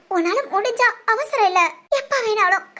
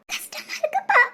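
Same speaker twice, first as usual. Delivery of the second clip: crying; 9 semitones higher than usual. Acted. Daughter grieving, voice breaking.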